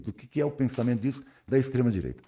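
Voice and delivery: ordinary talk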